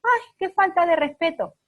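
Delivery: put-on mother scandalized by her teen's cheek, high and loud; indignant mother. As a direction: play the shocked, affronted mother. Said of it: "Lo mismo".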